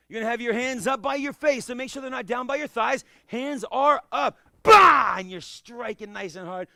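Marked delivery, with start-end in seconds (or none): 4.6-5.2 high loud "Bah!"; kia yell. Shown as a demonstration. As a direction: shout "Bah!" as you strike. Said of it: "that was Master Ali's kia".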